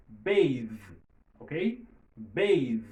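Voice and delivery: "bathe" loud, with a buzzing th; voiced final th. Shown as a demonstration. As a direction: punch "bathe" out and hum its final th. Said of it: "Les sonores". ("bathe" byen fò, th sonò)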